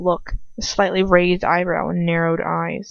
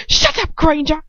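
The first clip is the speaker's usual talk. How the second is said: sharp, higher yell at Granger; angry shout; 9 semitones higher, 4 dB louder than usual. A narrator's reading. Draco shouting at Granger, angry. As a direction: snap it at her like a spoilt bully. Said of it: "Draco shouts".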